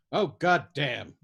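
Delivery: slow, loud and bitter; bitter exclamation. Acted. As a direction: spit it out slowly in bitter anger. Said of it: "Yossarian exclaimed bitterly".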